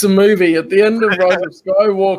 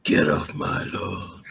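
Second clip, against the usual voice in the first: put-on low, slow old man grumble; grumpy old man; 9 semitones lower, 7 dB quieter, slower than usual. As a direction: growl it like a cranky old man.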